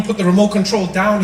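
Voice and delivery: normal talking voice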